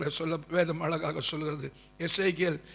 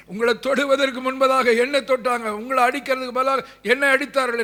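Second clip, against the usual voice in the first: raised, higher preacher's voice; impassioned preaching; 4 semitones higher, 5 dB louder than usual. Acted. Preacher tells believers He suffered before them, impassioned preaching. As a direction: drive each phrase home with mounting force.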